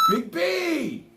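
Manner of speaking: excited shout-out. Real, not acted. Shouted thanks to donor.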